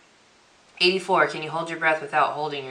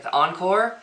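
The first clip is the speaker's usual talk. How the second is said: ordinary talk, nothing marked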